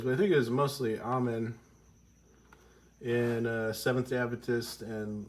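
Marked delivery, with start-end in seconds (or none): 1.0-1.5 "Amen" said as AH-men; ah-men pronunciation. Shown as a demonstration. Recalling churches they grew up in, "Amen" pronounced AH-men. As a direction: open AH starting "Amen". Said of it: "I heard it both ways".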